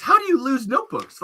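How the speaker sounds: can't believe he loses notebooks; incredulous. Real, not acted. Asking Wayne in disbelief how he loses notebooks.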